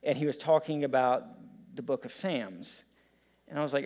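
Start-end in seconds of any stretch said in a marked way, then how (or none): 2.1-2.8 "Psalms" said as "Sams"; "Psalms" rhyming with "hams"; Scottish-style "Sams". Retold or imitated. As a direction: say "Psalms" with the vowel of "Sam". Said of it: "you pronounce Psalms as Sams".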